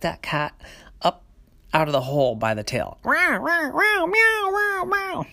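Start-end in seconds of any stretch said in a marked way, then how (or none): 3.0-5.3 high, loud cat yowl; angry cat yowl. Acted. Cat grabbed by the tail, yowling. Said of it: "cat didn't like being dragged".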